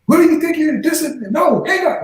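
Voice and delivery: high, indignant mock outburst; imitated telling-off. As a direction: mimic an irate stranger on the phone. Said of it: "literally cussed out a couple of times".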